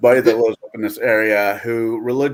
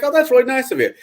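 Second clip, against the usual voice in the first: mock grateful friend; 12 semitones higher, much faster than usual. Friend thanks him for spiked tea.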